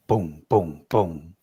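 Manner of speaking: three low, separate booms; deep thudding boom sounds; imitated stomps. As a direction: mimic heavy stomps, low and spaced. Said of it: "These three stomps".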